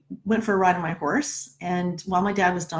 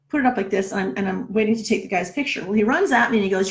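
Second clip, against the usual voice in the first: usual speaking voice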